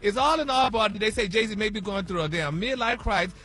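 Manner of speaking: celebrity news read. Acted. Host opening the celebrity news for listeners, fast and loud. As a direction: rattle it off like breaking news.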